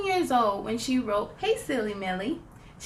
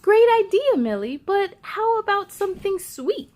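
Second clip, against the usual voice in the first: higher, louder praise; enthusiastic teacher; 6 semitones higher, 5 dB louder than usual. Acted. Teacher praising Millie's marching band idea, enthusiastic. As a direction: cheer the idea on.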